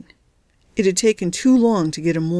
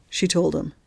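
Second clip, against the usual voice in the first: usual speaking voice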